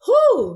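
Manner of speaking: a loud, high, blown-out whew of relief; relieved whew. Retold or imitated. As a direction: let it all out at the finish line. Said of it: "you're like".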